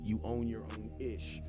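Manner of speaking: normal talking voice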